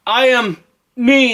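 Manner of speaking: high, slow proclamation; comically grand royal reply; young king answering. Acted. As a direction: announce yourself to the enemy.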